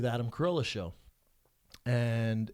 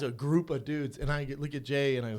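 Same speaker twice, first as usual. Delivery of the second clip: ordinary talk, nothing marked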